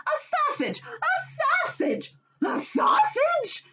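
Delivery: high, slow mocking shouts; shouting. Wife shouts at husband over the sausage, high mocking yells. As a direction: shriek it at him again and again. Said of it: "she shouted over and over again".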